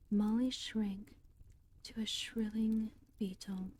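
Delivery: ordinary delivery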